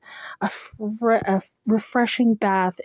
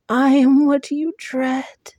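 higher, louder monster threat; louder hollow creature voice; 7 semitones higher, 5 dB louder than usual. Acted. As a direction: swell the threat into a roar. Monster repeats, growing louder.

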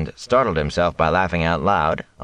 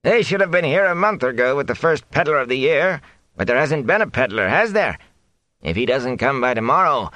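higher, needling complaint; complaining thatcher; 9 semitones higher than usual. A narrator's reading. Thatcher complaining to the villagers, higher and needling. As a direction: nag about the missing peddler.

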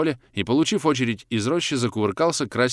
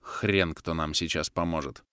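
low, slow, soft bitterness; spitting it out; 5 semitones lower, 6 dB quieter than usual. A narrator's reading. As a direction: say it slowly and quietly, resigned. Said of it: "сплюнул Агапов". (негромко, медленно, с досадой)

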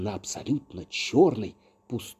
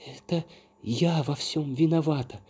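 crying out; 6 semitones higher than usual. A narrator's reading. Coco confesses to the fairy, crying out. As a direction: cry out a confession at raised pitch. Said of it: "крикнула Коко".

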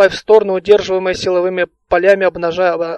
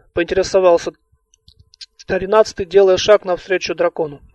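ordinary delivery

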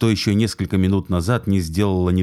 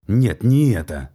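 slow, louder angry outburst; 3 semitones higher, 3 dB louder, slower than usual. Acted. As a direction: cut him off in fury. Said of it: "Натан буквально взбеленился".

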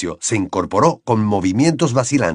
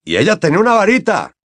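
higher, fast pointed reply; quick retort; 8 semitones higher, 4 dB louder, faster than usual. Acted. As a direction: Mr Diggory revealing the elf had a wand. Diggory's triumphant point.

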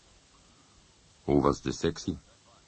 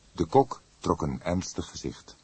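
ordinary delivery, nothing marked

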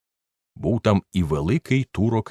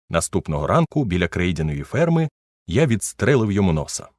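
ordinary delivery, nothing marked

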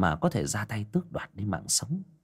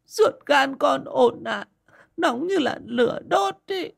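high wailing sobbing plea, much higher; 4 dB louder than usual. Acted. Grieving mother begs cụ Lân to take her along, wailing high. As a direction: wail to the old man, begging through tears. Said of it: "mà nức nở".

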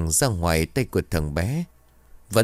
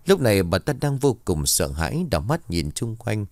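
ordinary delivery, nothing marked

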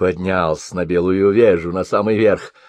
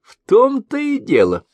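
laughing; 14 semitones higher than usual. A narrator's reading. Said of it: "засмеялся гусляр".

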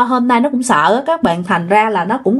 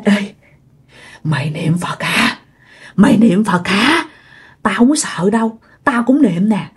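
ghost at the ear; 2 semitones lower than usual. Retold or imitated. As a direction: lean into the ear and taunt. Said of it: "cúi xuống sát cái lỗ tai của nó".

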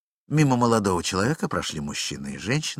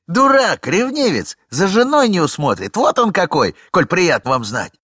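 loud, high, scornful tirade; angry insult; 9 semitones higher, 9 dB louder than usual. Acted. Young man scorning the jealous husband, loud and high. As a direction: spit out the insults at him.

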